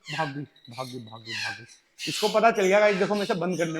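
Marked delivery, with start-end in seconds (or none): 0.1-1.6 quiet resigned mutter. Real, not acted. Bird escapes, muttering.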